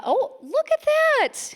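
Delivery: high, slow amazed look at that; real delighted surprise; surprised. Real, not acted. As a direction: react as if stunned by the crowd. Sees many raised hands.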